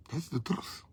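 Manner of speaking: hushed confession; whispered aside. Real, not acted. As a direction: drop to a confiding whisper. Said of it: "quiet aside after political point".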